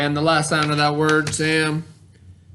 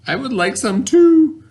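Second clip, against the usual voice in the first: playful joke; 10 semitones higher, 5 dB louder than usual. Acted. Joking aside after the word.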